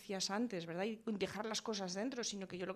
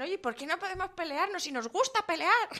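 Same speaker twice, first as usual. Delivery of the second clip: higher, louder mock kid voice; child's protest; 8 semitones higher, 8 dB louder than usual. Children arguing back to parents, mock child protest. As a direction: play the kid protesting cheekily. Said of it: "Muchos dirán".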